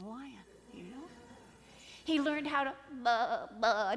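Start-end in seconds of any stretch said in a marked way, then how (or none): 3.0-4.0 loud imitated baa; sheep bleat. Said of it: "to bleat like a little sheep".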